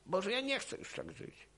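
a desperate man sighing a prayer, soft and high; retold sighing plea. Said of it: "westchnąłem właściwie".

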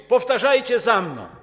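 mock preacher's loud call; loud command. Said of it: "i mówić".

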